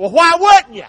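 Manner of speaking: high, loud emphatic repeat; loud preacher repeat; loud punch. Real, not acted. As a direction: hammer the phrase home loudly. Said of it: "preacher punches phrase".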